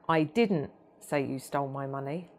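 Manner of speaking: "didn't" lifted, then a small pause; stress on DIDN'T. Shown as a demonstration. Trainer shows how tone shifts meaning, stress on "didn't". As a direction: hit the denial word "didn't". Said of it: "spoken in several different ways".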